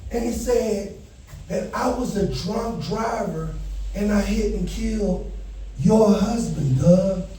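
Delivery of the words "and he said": ordinary talk, nothing marked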